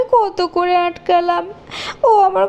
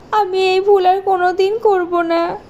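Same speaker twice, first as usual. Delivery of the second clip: usual speaking voice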